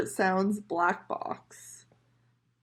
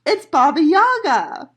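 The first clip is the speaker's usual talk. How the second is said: loud, high gleeful reveal; excited reveal; 7 semitones higher, 9 dB louder than usual. Real, not acted. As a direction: announce it with glee.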